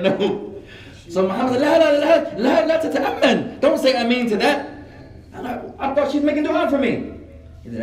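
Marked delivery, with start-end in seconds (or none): 1.6-4.7 high, loud, urgent protest; alarmed interruption. Retold or imitated. Friend urgently stops him. 5.8-7.1 high-pitched, innocent protest; bewildered excuse. Retold or imitated. His bewildered excuse to friend.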